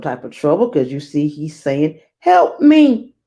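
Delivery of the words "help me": high, loud cry for help; mock distressed king crying help; pleading king. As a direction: call out like a frightened king. Teacher voices the king's plea.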